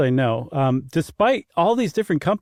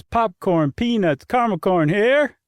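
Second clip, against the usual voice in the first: stadium vendor hawking; 6 semitones higher than usual. Shown as a demonstration. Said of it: "The one I always remember is".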